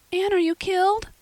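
high, loud panicked shriek. Acted. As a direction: cry out in terror for a fallen friend. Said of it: "shrieked Diana".

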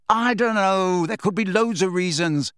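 high, exasperated yell. A narrator's reading. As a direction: yell back at her in an argument. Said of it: "Harry yelled at her".

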